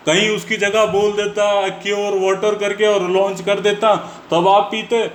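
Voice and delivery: worked-up lecture to the woman in the clip; heated rant. Real, not acted. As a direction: press the point hard, almost shouting.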